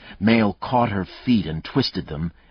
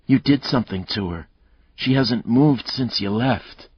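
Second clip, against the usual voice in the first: frightened; 7 semitones higher, 4 dB louder than usual. Acted. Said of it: "Grace, frightened, said".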